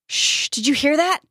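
high, alert question; urgent hushing. A narrator's reading. Jack hushing Dustin to listen, urgent. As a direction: hush them, then ask, pitch lifted.

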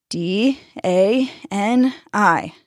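slow letter-by-letter spelling; spelled out slowly. Acted. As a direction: spell each letter as you write. Spelling letters while writing.

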